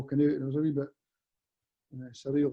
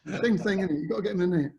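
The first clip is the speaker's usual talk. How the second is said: higher, rough Cockney imitation; Frank Lampard; 5 semitones higher, faster than usual. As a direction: replay the punchline in mock Cockney. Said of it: "Cockney accent, maybe not too good".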